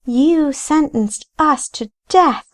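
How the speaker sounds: high, ghostly accusing voice; eerie high pitch. A narrator's reading. Ghost Rachel accuses Paxton in an eerie high voice. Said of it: "risen to an eerie pitch".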